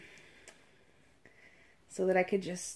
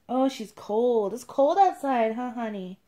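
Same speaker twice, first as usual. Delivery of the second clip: usual speaking voice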